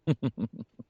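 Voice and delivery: light falling giggle; laugh. Acted. Demon recalling the werewolf fling, a light giggle. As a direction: giggle at the memory.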